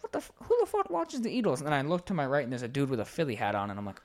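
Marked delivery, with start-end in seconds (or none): 0.0-1.6 high, louder incredulous outburst; retold indignant confusion, high-pitched; baffled disbelief. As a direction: replay your bewilderment, voice leaping way up. Retold bafflement at Eagles fans.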